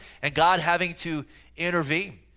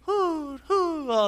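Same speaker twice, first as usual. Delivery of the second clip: gentle owl hoot; 13 semitones higher, slower than usual. Retold or imitated. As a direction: coo like a soothing little owl. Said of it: "And it's not a".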